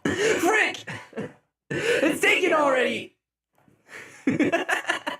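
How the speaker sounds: high-pitched playful indignation; mock outrage. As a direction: wail in comic dismay. Mock outrage at taken tune.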